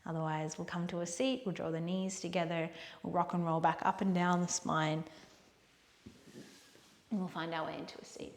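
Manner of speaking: soft, hushed yoga closing voice; quiet, unhurried instruction with long pauses; guided relaxation. Real, not acted. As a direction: guide them softly toward rest.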